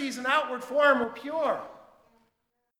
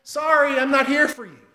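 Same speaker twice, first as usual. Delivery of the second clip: much louder, raised voice over a crowd; booming public refusal, higher pitched; loud announcement; 4 semitones higher, 11 dB louder than usual. Retold or imitated. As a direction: say it loudly for all to hear. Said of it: "Jesus stops and says loudly".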